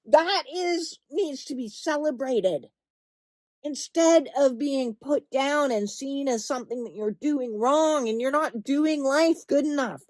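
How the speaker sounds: ordinary delivery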